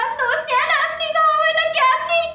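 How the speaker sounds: high, fast, anguished wail; anguished outburst. Acted. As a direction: burst out crying for your son. Mother bursts out for son. (انفجار باكٍ بصوت مرتفع)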